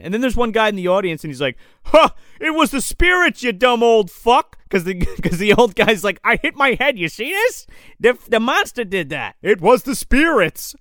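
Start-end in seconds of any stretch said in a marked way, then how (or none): 1.8-4.6 much higher, louder jeering heckler; hammed-up mocking spectator. Spectator heckling the old man. 6.2-9.3 put-on higher, pleading old man; lightly voiced frantic old man; mock old man. 9.4-10.8 hammed-up indignant yell; mock outburst. Comic shouting parody.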